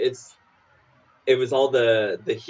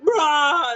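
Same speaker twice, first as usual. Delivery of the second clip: high mock zombie rah; cartoon monster growl; playful zombie roar.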